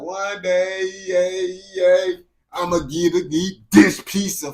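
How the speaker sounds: hammed-up yearning voice; mock dreamer. Mocking how UK people talk.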